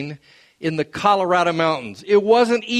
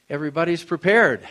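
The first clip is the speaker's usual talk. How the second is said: normal talking voice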